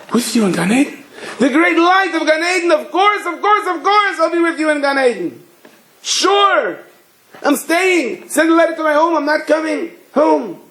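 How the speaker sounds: very high-pitched, eager agreement; acted elated amazement. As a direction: gush with over-the-top delight. Visitor overjoyed at promise of Gan Eden.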